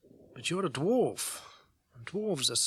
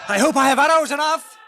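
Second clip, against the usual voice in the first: loud, high cry over the fighting; battle shout; 13 semitones higher, 15 dB louder than usual. Acted. Elf archer calls out amid the battle, shouting. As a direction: shout it as you loose arrows.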